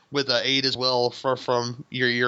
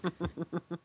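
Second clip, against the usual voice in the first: chuckle; 3 semitones higher, 12 dB quieter than usual. Real, not acted. Host amused by the rifleman idea, quiet chuckle.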